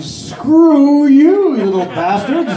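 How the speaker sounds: high, sudden spiteful jab; mock inner snarl. Comic thinking nasty thoughts at millennials, mock snarl. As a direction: spit it out in a squeaky rage. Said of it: "But I'm thinking in the back of my mind".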